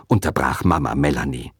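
ordinary delivery, nothing marked

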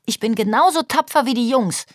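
lightly acted childish indignation; mildly scolding child. A narrator's reading. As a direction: grumble defiantly like a small girl. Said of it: "schimpft Jule".

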